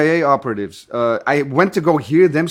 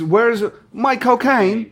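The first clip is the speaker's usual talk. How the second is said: slow, laughing Cockney-style shout; Michael Caine; 7 semitones higher, slower than usual. Retold or imitated. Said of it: "Michael Caine in English".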